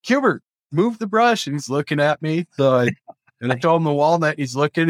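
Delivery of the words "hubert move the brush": high, light order to the parrot; mock commanding Hubert. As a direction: tell the bird off playfully. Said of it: "So I'm like".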